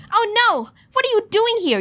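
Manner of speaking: high, alarmed cartoonish voice without the accent. Creator revoicing alarmed Boy Kisser, high voice with no accent. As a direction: drop the British accent, keep it high and fretful. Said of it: "no accent".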